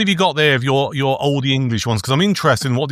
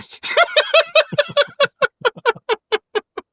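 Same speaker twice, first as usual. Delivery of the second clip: high giggling laugh. Real, not acted. Laughing at his own burp.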